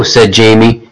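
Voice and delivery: ordinary delivery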